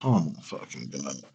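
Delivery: oh my God, said softly to herself in despair; exasperated groan. Real, not acted. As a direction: sink into disbelief, barely audible. Exasperated at friend's rambling.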